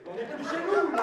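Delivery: loud, high, laughing outburst; outraged shout. Acted. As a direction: burst out in shocked outrage. Outraged discovery in the scene.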